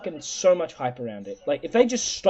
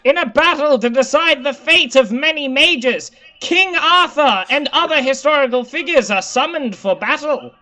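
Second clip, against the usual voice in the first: high, loud dramatic read; 8 semitones higher, 7 dB louder than usual. Acted. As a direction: present it like a quiz host. Reads quiz prompt dramatically.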